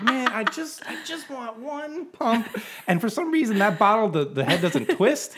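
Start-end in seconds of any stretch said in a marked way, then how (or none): 0.0-2.5 much higher, slow whiny mock complaint; mock whine. Retelling her shower frustration to the co-host, mock whine. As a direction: whine it like a fed-up roommate. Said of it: "I'm like".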